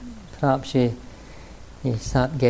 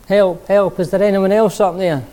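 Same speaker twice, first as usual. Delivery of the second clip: high, loud shout for someone else; the punchline shout, comically desperate; yelling; 6 semitones higher, 5 dB louder than usual. Acted. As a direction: call out again, hoping for another answer. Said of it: "Jack yelled".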